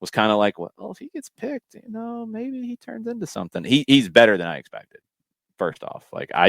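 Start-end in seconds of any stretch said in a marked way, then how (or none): 0.6-3.6 much higher, half-hearted hedge; shrugging doubt. Retold or imitated. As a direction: toss it off like a shrug. Quoting his own past doubt.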